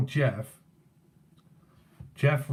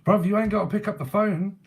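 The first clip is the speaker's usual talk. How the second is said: cheeky; 7 semitones higher, 5 dB louder than usual. Real, not acted. Jokes back at the spirit.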